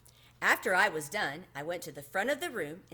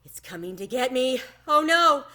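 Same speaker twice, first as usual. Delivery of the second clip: raised, frightened cry; kind of loud; 5 semitones higher, 9 dB louder than usual. A narrator's reading. Child dreading the school bus, a loud fearful cry. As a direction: blurt it out in a panic. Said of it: "I said kind of loud".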